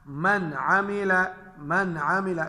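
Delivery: "मन आमिला" with a short, clear n; izhar recitation. Shown as a demonstration. Teacher gives students an ain example, unhummed n in "मन आमिला". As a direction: release the n of "मन" cleanly into "आमिला". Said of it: "ये नहीं पढ़ेंगे".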